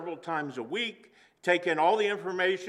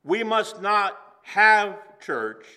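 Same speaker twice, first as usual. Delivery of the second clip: preached emphasis; 4 semitones higher, 6 dB louder, slower than usual. Acted.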